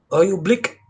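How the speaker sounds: mock South African accent. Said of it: "Is that a South African accent".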